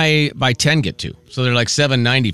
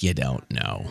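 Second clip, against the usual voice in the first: knowing look voiced; 13 semitones lower, 9 dB quieter, slower than usual. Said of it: "gave me the look like".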